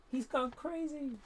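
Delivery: squeaky high-pitched mock voice; silly high voice. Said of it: "anyone thinking".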